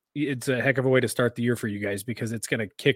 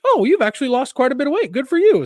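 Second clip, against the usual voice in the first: much higher, louder clownish doctor; 13 semitones higher, 9 dB louder than usual. Retold or imitated. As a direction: play the doctor like a circus clown. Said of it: "is he from the circus or something".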